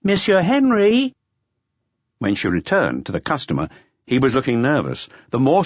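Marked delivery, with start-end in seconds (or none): 0.0-1.1 higher, louder call up the passage; drawn-out call. Acted. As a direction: call him from below.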